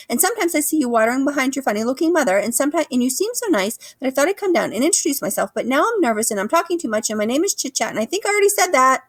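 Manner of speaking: very high, fast, breathless rambling; a squirrel rambling on nervously; nervous chattering squirrel. A narrator's reading. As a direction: babble on, nervous and eager.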